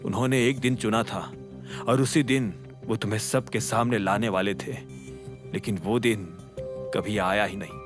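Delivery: subdued and weighed down, fading at the end; voice growing heavy. A narrator's reading. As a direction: let the voice grow heavy with regret. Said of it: "अकरम की आवाज भारी हो गई".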